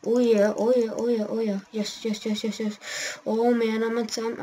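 ordinary talk

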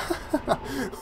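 soft high laugh; high giggle. Real, not acted. Gamer giggling at the body's weird twitching. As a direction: giggle at the absurd sight.

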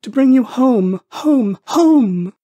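put-on high, loud childish jubilation; gleeful little girl. A narrator's reading. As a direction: cry it out like an overjoyed child. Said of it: "said the child".

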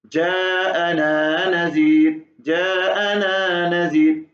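जाअना with a long drawn aa, very slow; stretched jaana. Shown as a demonstration. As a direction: recite जाअना Nazir with a long aa. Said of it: "वहां लंबा करेंगे". (लंबा खींचा हुआ जाअना)

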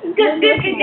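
normal talking voice

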